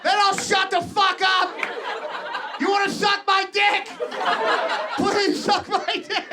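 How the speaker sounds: a rooster begging the whole town at four a.m; horny rooster. Acted. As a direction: yell it like a frantic horny rooster at dawn. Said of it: "they open their eyes and are like".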